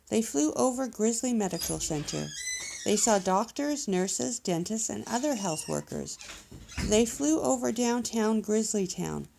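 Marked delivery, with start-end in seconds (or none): none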